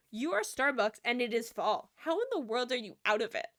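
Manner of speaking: loud, emphatic disbelief; mock outrage. Customer retelling shock at Starbucks running out, mock outrage. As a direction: take them to task, incredulous. Retold outrage at sold-out syrup.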